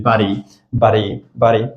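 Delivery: "but he" as Buddy, with a fast D; Buddy as one linked word; flapped T. Shown as a demonstration. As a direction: tap the D in Buddy lightly.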